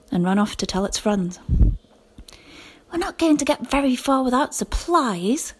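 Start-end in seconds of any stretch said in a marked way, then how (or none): none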